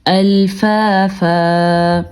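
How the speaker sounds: slow الفافا with both long فا; pausing form. Shown as a demonstration. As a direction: hold the final "faa" of الفافا, no n. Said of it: "یہ ایک الف بن جائے گا". (الفافا، آخر میں الف کی آواز)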